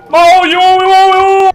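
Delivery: high, loud held cries; worked-up yell on the run; panicked yelling. Real, not acted. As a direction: shout back frantically. Panic while fleeing in game.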